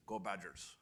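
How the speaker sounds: light imitation of a father's Russian accent; a hint of a Russian accent, said fondly. Guest quoting his father cheering for the university, in a light Russian accent. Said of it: "in his Russian accent".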